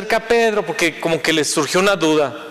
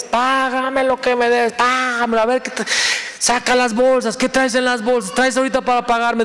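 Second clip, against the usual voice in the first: higher, pushy demand for payment; demanding creditor; 5 semitones higher than usual. Acted. Pastor voicing the servant choking his debtor, a higher, hammed-up demand. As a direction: grab him and demand your money back.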